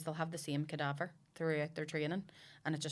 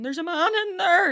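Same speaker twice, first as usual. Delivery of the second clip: much higher and louder panicked cry; mock scream; 14 semitones higher, 14 dB louder than usual. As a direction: shriek it like a kid fleeing the booth. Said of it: "ran out screaming".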